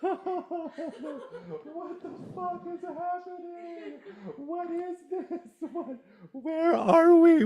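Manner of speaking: very high, drawn-out squeal; mock freak-out. Freaking out at existence, hammed-up high squeal. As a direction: squeal in slow, hammed-up panic.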